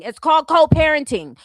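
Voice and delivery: emphatic repeat. Real, not acted. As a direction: spell it out loudly.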